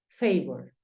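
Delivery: favor said the English way, fey-vor; English pronunciation. Shown as a demonstration. Teacher modelling English favor for students. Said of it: "Entonces tú dices".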